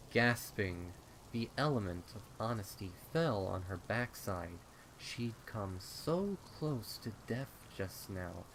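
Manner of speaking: plain reading voice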